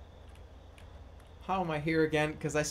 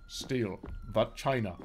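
low, flat deliberate repeat; low robotic mantra; 6 semitones lower than usual. Shown as a demonstration. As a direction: mutter it like a programmed line. Repeating game line in low voice.

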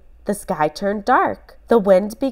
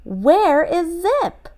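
put-on high, slow boy voice, anxious; a boy searching in worry; little Ben pleading for his dog; 9 semitones higher, slower than usual. A narrator's reading. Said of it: "cried Ben".

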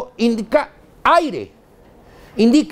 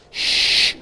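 steady air hiss; 3 dB quieter than usual. Shown as a demonstration. Imitates continuous air.

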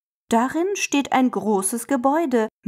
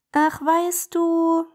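higher, slow, smiling words; 7 semitones higher, slower than usual. Acted. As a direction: say it with a smile. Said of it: "lächelt der kleine I C E". (lächelnd und langsam)

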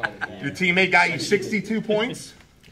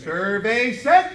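drawn-out game show host; 2 semitones higher, 5 dB louder, much slower than usual. Acted. Host drawing out reveal.

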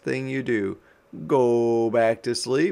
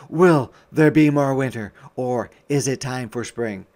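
ordinary delivery, nothing marked

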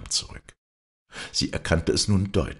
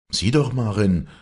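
raised, higher call for attention; 6 semitones higher, 6 dB louder than usual. A narrator's reading. Young man to girl in the boat, calling out. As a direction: call her to look, louder. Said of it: "rief er".

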